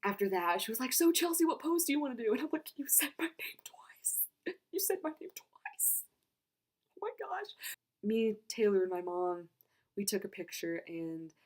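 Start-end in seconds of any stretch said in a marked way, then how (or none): 0.9-2.3 high, loud and chirpy quote; perky retold question. Voicing the star's cheerful question. 2.8-7.5 high, whispery squeal of disbelief; whisper-squeak it, overcome that the idol knows you; awestruck. Retold or imitated. Retelling her awestruck reaction.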